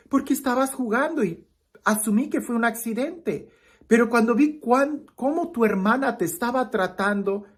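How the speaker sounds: a lightly voiced grandmother explaining kindly; grandmother's lesson. Grandmother explaining to her grandson, lightly voiced. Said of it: "la abuelita dice".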